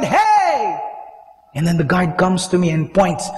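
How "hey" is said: high-pitched angry shout for attention; mad yell. Retold or imitated. Banging on the glass door to get the guard, mad yell. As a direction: re-enact yelling through a glass door. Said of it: "I got so mad".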